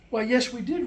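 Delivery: ordinary delivery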